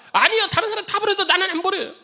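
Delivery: high, loud stubborn insistence; protesting Peter. Acted. Peter insisting to Jesus he won't leave, high and loud. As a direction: push back loudly like a proud disciple.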